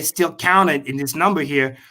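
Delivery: ordinary talk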